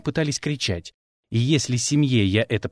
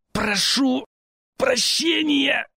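strained, much higher rasp; a beaten boy croaking an apology; wheezed apology; 11 semitones higher, much slower than usual. A narrator's reading. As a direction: force the words out in pain. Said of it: "прохрипел Демер".

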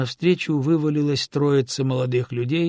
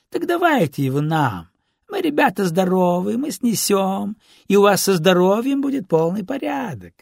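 higher, sing-song helpful menace; mock-polite street robber; 7 semitones higher, 3 dB louder than usual. A narrator's reading. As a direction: offer help that is really a robbery. Robber goes on demanding the purse. (налётчик с притворной услужливостью)

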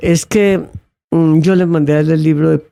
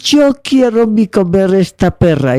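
put-on pitched-up Czech-accented Spanish; 5 semitones higher than usual. Boy shows the pear to the household, Czech accent. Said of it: "el acento de Checoslovaquia".

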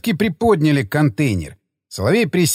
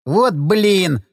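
higher, drawn-out letdown sigh of words; a lightly voiced man, let down; disappointed drawl; 6 semitones higher than usual. A narrator's reading. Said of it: "разочарованно протянул Павел".

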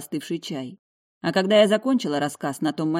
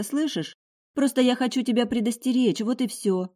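hurried, higher mother voice; mother rushing to soften her words; hasty mother; 7 semitones higher, 4 dB louder than usual. A narrator's reading. Mother hastily reassuring daughter, hurried higher voice. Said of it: "поспешно добавила ма".

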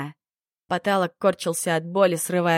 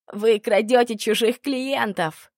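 desperate outburst; 4 semitones higher, 4 dB louder, slower than usual. Acted. Tortured man blurts out.

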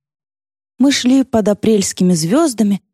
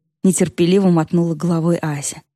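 plain reading voice

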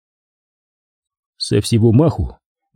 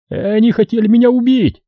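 high-pitched wailed accusation; high, agitated outcry; squealing; 11 semitones higher than usual. A narrator's reading. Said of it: "верещал сзади Мадаев".

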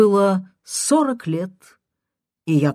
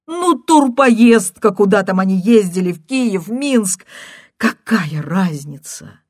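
tearful caller; 3 semitones higher, 7 dB louder than usual. Acted. Caller brushes off his question, tearful and loud. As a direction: brush it off through tears.

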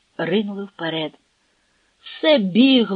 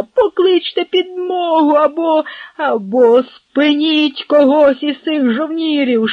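high, loud frantic plea; panicked urgent call, high and loud; urgent hiss; 7 semitones higher, 5 dB louder, slower than usual. Acted. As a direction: Potocki begging his servant to run for help. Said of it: "засичав".

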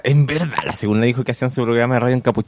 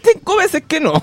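much higher mock insistent voice; imitating a pushy refusal, pitched way up. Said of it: "Y le decía".

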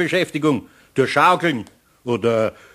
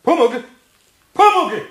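calling out; 13 semitones higher than usual. Acted. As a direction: call sharply after the runaway. Eder calling after the kobold.

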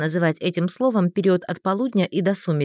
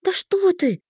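high-pitched brush-off; dismissive; 9 semitones higher, slower than usual. A narrator's reading. Heroine brushes off the mage's worry, dismissively. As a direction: wave it away, pitched up. Waving off his concern.

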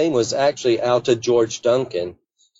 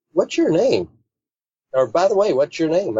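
a higher, lightly voiced Alta; quoting Alta; 6 semitones higher than usual. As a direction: ask it up high, a hint of her curious voice. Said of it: "she goes".

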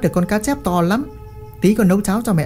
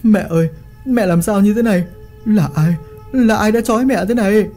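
crying son; 6 semitones higher than usual. A narrator's reading. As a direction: plead through tears. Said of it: "vừa khóc".

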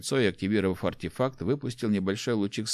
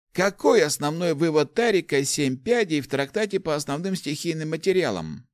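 put-on higher-pitched, animated old man's voice; enthusiastic old inventor; 8 semitones higher than usual. Old inventor explains artefacts to the rector, enthusiastic. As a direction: explain your discovery eagerly, pitched up.

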